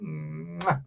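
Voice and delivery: soft smacking air kiss to the audience; quiet mwah kiss; blown kiss. Real, not acted. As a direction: blow them a kiss. Blowing kisses to viewers.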